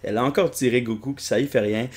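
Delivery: ordinary talk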